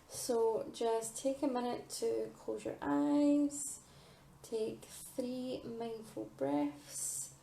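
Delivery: slow, soft meditation voice; guided meditation. Acted. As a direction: ease them into calm breathing. Guides listeners through mindful breathing.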